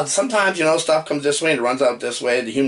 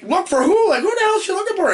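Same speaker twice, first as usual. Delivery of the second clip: high, fast baffled outburst; confused outburst; 3 dB louder, faster than usual. Retold or imitated. Host reacting to the movie plot, high confused outburst. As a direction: blurt it, completely lost. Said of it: "And I'm like".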